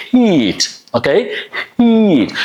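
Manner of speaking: breathy H and long E in "heat". Shown as a demonstration. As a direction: push air out on H, then long ee in "heat". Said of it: "A is a long E vowel sound".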